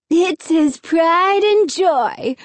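mocking sneer; scornful, slow put-down. A narrator's reading. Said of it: "Alan sneered".

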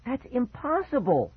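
high cry of disbelief. A narrator's reading. Girl protesting to her friend, crying out. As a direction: pitch it way up in protest. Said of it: "Fergie cried".

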